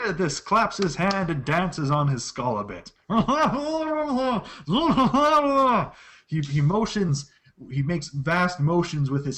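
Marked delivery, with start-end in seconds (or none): 3.0-5.9 high gleeful creature laugh; creature giggle. Acted. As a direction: cackle with delight as the little creature.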